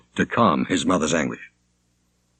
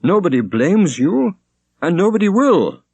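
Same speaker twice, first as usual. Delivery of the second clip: a hint of a haggard young man, higher; the son pleading with his wailing mother; 10 semitones higher, 4 dB louder than usual. A narrator's reading. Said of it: "haggard and sweating".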